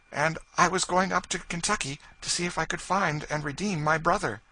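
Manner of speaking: high, loud earnest lady; high lady's voice. Acted. Lady telling George her history, high and earnest. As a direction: tell her story with feeling.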